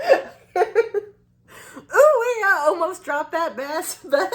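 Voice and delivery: high, loud whoop breaking into laughter; restaurant worker's whoop. Retold or imitated. Restaurant worker who almost dropped the bag, whooping impression. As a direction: imitate him gleefully, cracking up as you go. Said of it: "I'll try to do it as best as I can".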